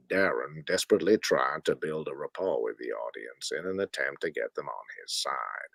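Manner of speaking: deep hushed film narrator; Morgan Freeman narration. Acted. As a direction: narrate your own flirting like a wildlife film. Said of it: "So I'm narrating".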